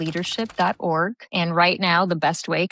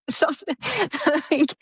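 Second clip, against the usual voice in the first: laughing; 10 semitones higher than usual. Real, not acted. Laughing at ex-husband irony.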